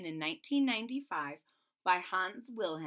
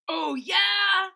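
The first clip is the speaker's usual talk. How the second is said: high, loud taunting bully voice; bully's jeering shout; 10 semitones higher, 11 dB louder than usual. Acted. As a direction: yell it like a big mean dinosaur spotting prey. Bully dinosaur Tyrone voiced.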